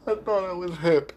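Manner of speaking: a chuckling self-mocking confession; laughing. Real, not acted. Host mocking himself while still laughing, laugh in voice.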